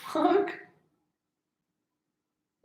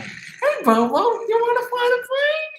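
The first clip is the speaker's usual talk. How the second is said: very high falsetto coaxing; calling a pet; 5 dB louder, slower than usual. Retold or imitated. Star inviting his monkey to fly, high singsong call. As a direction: squeal it drawn out, as to a pet.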